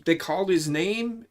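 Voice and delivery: ordinary delivery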